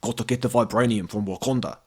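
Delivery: a quiet South African villain voice; South African accent. Retold or imitated. For the podcast, Ulysses Klaue in a South African accent. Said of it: "I just kinda channel, uh, Andy Serkis being Ulysses Klaue".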